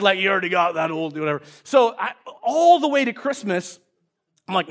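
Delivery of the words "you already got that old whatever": lightly voiced grumbling dad, lower; a hint of dismissive dad; typical old dad. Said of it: "typical old dad".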